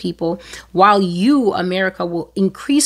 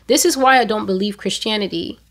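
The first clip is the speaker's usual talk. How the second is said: usual speaking voice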